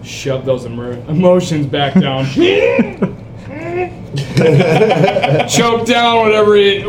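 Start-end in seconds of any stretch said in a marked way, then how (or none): 2.3-5.4 bursting into high laughter; big delighted laugh; loud laugh. Real, not acted. Player cracks up at scene.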